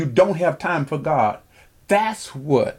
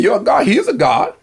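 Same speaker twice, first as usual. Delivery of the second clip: high, louder scornful jeer; mocking taunt; 8 semitones higher, 4 dB louder than usual. Elijah scorning the prophets' god, high mocking jeer. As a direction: jeer at their false god. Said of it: "Elijah mocked them and said".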